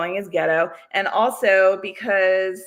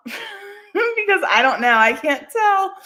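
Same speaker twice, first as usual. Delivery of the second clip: laughing; 10 semitones higher, 4 dB louder, slower than usual. Real, not acted. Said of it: "struggling with screen share".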